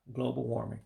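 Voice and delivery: ordinary delivery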